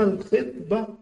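الخطبة pronounced KHIT-ba; kasra on the kha. Shown as a demonstration. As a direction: put a short i after kh in الخطبة. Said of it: "بكسر الخاء".

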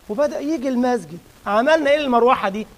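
ordinary talk, nothing marked

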